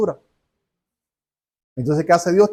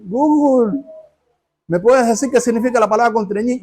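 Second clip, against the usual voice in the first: higher, lightly voiced query to a phone assistant; asking Google; 6 semitones higher than usual. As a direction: chirp the question at the phone. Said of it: "le preguntas a Google".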